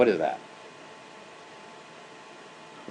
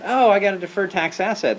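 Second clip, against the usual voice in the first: higher-pitched mock eager announcement; acted announcement; 9 semitones higher, 3 dB louder than usual. US colleague announcing a tax asset to German controller, mock eager. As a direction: voice a colleague breaking news, pitched up.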